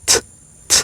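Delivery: short, clean T with no glottal catch; plain T sound. Shown as a demonstration. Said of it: "El primer sonido de la T es de la siguiente manera". (t simple como en español)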